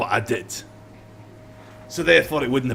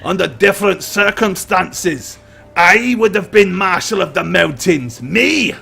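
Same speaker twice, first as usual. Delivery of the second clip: louder, higher bitter grievance, slow at first; bitter rant; 4 semitones higher, 5 dB louder, slower than usual. Acted. Prisoner ranting to his captors about a lost title, bitter anger. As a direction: spit out the lost title with resentment. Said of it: "He said".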